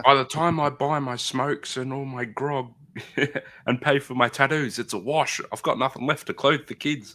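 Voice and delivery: high-pitched mock bogan voice. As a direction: play a hard-up bogan local. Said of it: "Bogans are great".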